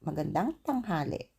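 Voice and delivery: Magandang tanghali said quickly together; normal speed. Shown as a demonstration. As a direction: run Magandang tanghali smoothly. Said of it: "Then you make it a bit faster".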